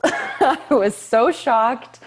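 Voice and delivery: high, loud, slow words through laughter; laughing shock. Real, not acted. Actress recalling her surprise booking call: laughing, high and loud.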